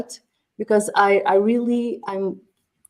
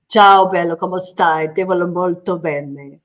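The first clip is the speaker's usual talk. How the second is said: louder, playful Italian accent, opening with a burst; Italian accent on request; 2 semitones lower, 6 dB louder than usual. Shown as a demonstration. Guest repeats her Italian on request, louder Italian accent. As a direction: perform the Italian line for the hosts, hamming the accent. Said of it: "I'm so sorry for my accent".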